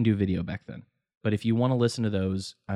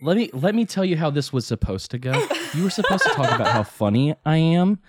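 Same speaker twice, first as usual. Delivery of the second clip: laughing; 6 semitones higher, 5 dB louder than usual. Real, not acted. Mock complaint, laughing.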